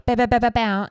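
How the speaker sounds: loud high chanted bow; mock chant. Mimicking the priest's bows.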